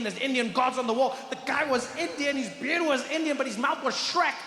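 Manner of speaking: high-pitched, worked-up disbelief; incredulous rant. Acted. Explaining the waiter's unexpected accent, high and incredulous.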